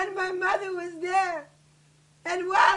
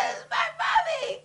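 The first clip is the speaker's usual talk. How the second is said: high, sobbing cry; grieving wail; 10 semitones higher than usual. Real, not acted. Daughter recognizing her mommy, high grieving wail. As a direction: wail it through sobs. Grief over mother's body.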